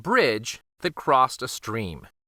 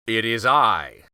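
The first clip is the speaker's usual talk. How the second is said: a hint of a big goat growl; large goat growl; 2 semitones lower than usual. A narrator's reading. Biggest goat growls back at the troll, lightly voiced. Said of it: "growled a large voice".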